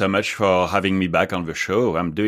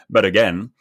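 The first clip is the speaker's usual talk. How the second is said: ordinary delivery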